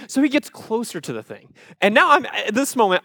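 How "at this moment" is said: ordinary talk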